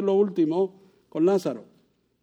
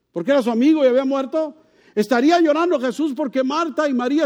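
high, loud pleading questions; fervent preaching; 11 semitones higher, 5 dB louder than usual. Acted. Preacher asking his congregation why Jesus wept, fervent preaching. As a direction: preach each question harder, pressing the crowd. Rhetorical questions at full force.

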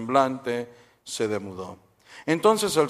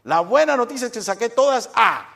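put-on high, louder boyish boast; proud kid; 9 semitones higher, 5 dB louder than usual. Juanito to dad, boasting his grades in a high, loud voice. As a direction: show off the good news, much higher.

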